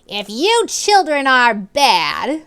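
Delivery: slow, loud, threatening teacher voice; drawn-out villainous threat; menacing warning. Acted. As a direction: warn the children like a wicked teacher. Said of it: "she warned".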